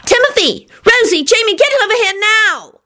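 very high yelled summons; urgent calling out. Acted. Quentin calls the family over, urgent yell. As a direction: call them over frantically.

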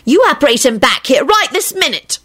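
high, demanding protest; acted high-pitched scolding; indignant yell. A narrator's reading. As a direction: order them about in a high voice. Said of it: "Rosie yelled".

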